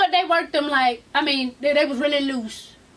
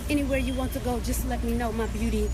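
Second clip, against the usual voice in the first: low, soft, suave sweet-talk; smooth-talker voice, dropped low and quiet; smooth suitor; 6 semitones lower, 6 dB quieter than usual. Retold or imitated. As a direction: imitate a man pouring on the charm. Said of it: "y'all should have heard him".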